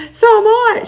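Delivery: very high, loud mock squeal; excited squeal. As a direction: imitate a thrilled fellow countrywoman shrieking. Acting out delighted fellow Australian.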